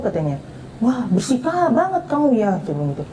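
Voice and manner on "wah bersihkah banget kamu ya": higher-pitched retold amazement; amazed reaction. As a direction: react impressed, pitch lifted. Retelling her amazed reply to friend. (kagum, nada naik)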